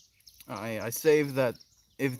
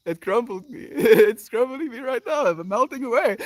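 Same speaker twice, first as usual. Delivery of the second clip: overcome with emotion; 8 semitones higher, 6 dB louder than usual. Real, not acted. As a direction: voice climbing higher and louder, laughing through tears. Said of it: "if I'm being a little bit emotional".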